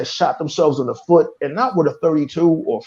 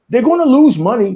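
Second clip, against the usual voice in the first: louder and higher on lose money; loud rant; 5 semitones higher, 5 dB louder than usual. Real, not acted. Fan ranting at studio, raised voice. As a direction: shout it out, lose stressed.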